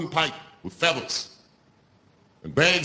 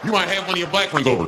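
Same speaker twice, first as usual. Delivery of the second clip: normal talking voice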